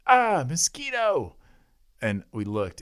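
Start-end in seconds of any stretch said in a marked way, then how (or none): 0.1-1.4 excited shout. Retold or imitated. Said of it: "he goes".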